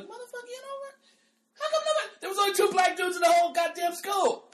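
high-pitched indignant rant building to a shout; hammed-up protest at being forgotten; acted outrage. Acting outrage at not being remembered.

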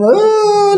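high drawn-out "oh" wail; imitated devotee's wail. Teacher imitating devotees lost in God, mock wailing. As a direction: mimic an ecstatic devotee crying out, high and held. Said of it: "like that they are going. They are crying".

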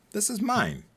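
high mine-grabbing whine; childish whine. Acted. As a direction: whine it like a kid. Segment title acted out.

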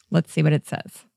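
ordinary talk

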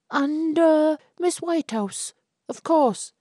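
high, hesitant suitor voice; polite young man. Acted. Albert nervously greets the chaperone, high and hesitant. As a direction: stumble politely over the chaperone's name.

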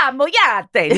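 high Italian-sounding flourish. Real, not acted. Co-host repeating the Filipino word, Italian-sounding. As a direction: say it with an Italian-style lilt. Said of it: "It sounds Italian the way you're saying it".

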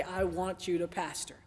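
very low, hushed divine voice; quiet, deep call from above; voice of the Lord. Retold or imitated. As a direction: drop low and soft, as God calling. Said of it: "the voice of the Lord say".

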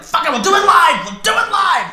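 mock Bill O'Reilly rant, shouted high; furious yell. Guest quoting O'Reilly after missing the train, furious shout. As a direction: bellow it like a TV host losing it. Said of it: "I'm like".

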